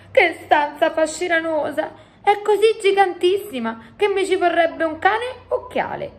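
high, wonder-struck giant voice; giant exclaiming. A narrator's reading. Giant marvelling at the enormous room, exclaiming high. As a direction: marvel aloud, pitched right up. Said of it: "esclamò".

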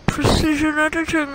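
high, lisping through a retainer; wearing a retainer. Real, not acted. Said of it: "Can't say it when my retainer".

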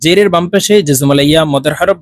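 ordinary delivery

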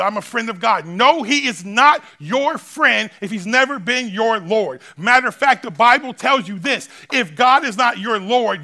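loud, driving preacher's cry; preaching at full force. Acted. Preacher pressing the congregation to settle it, full-force preaching. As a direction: hammer each line home to the crowd.

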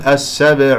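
السبع with the ب left vowelless and bounced; mistaken pronunciation. Shown as a demonstration. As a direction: say السبع wrongly, dropping the vowel on ب into an echo. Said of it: "يخطئ فيها الكثير بأنه يقول". (نطق خاطئ لكلمة السبع بإسكان الباء وقلقلتها)

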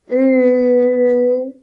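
L sounded out long and loud, "L" held; letter sound demo. Shown as a demonstration. Teacher to children showing how L sounds, loud and drawn out. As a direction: stretch the "L" so the kids hear it. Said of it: "la L suena L".

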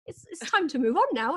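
mock-wise voice telling her to move on; a hint of a stern inner voice; voice of age thirty. A lightly voiced inner voice announcing it's time to move on. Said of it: "it was like".

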